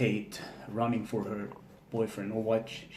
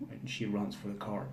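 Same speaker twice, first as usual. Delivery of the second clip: usual speaking voice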